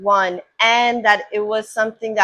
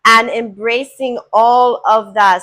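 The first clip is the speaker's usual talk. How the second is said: normal talking voice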